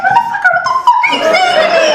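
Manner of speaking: outraged. Retold or imitated. Wife screams at her husband over the phone, furious and high. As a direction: explode at him in disbelief.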